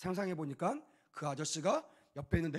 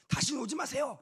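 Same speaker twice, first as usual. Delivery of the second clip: high, sharp mock scolding; imagined angry retort; 9 semitones higher, 5 dB louder than usual. Father tells the pastor never to come back, mock anger.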